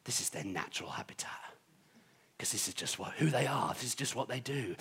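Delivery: whispered wildlife narrator watching a rare creature; soft, low documentary hush, as if spotting an animal; hushed nature documentary. Pretend.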